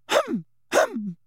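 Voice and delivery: very high, prim "hem, hem"; high-pitched attention-seeking hum; affected little throat-clearing. A narrator's reading. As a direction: interrupt with a dainty fake cough. Umbridge's interrupting little cough.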